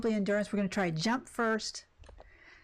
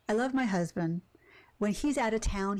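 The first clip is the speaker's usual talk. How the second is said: ordinary talk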